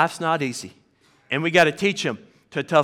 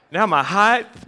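laughing; 4 semitones higher, 5 dB louder, slower than usual. Real, not acted. Laughing at losing height.

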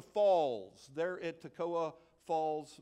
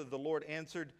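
ordinary talk, nothing marked

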